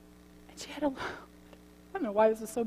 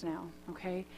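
usual speaking voice

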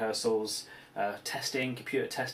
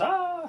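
sudden high-pitched monster shriek; one short shriek as the creature turns; zombie scream; 3 dB louder than usual. Retold or imitated. Zombie turning on a crewman, sudden high shriek. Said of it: "uh, and goes".

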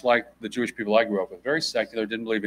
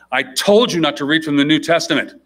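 louder mock yell of an angry mother; retold scream; 5 semitones higher, 10 dB louder than usual. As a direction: play the furious mom, loud. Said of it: "her mom starts screaming at her".

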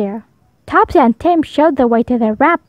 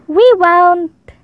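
high, louder chorus of promise; children promising; 6 semitones higher, 4 dB louder than usual. A narrator's reading. Children promising their teacher together, high and loud. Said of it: "promised all the children".

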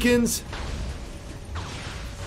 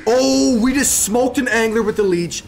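hyped gamer bro; 7 semitones higher than usual. Real, not acted. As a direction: hype up the kill like a streamer. Hyped after kill.

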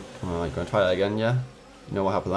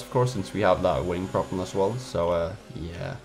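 usual speaking voice

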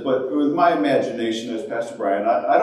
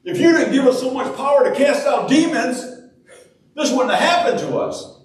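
high-pitched mock complaint to God; hammed-up aggrieved accusation; acted blaming; 9 semitones higher than usual. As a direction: protest as if it's all God's fault. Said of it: "go as far as saying".

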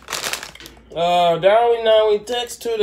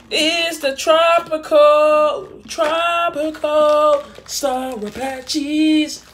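drawn out; 8 semitones higher, much slower than usual. Real, not acted. Announcing candy flavor.

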